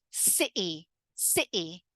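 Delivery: "city" as "ci'y"; little pause in place of the T in "city"; glottal T. Shown as a demonstration. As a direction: cut the T in "city". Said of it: "I actually have a little pause".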